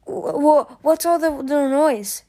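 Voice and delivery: high, stammering sleepy mumble; mumbled. A narrator's reading. Just woken by the crash, stammering mumble. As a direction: stumble over the words, just woken. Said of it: "she mumbled".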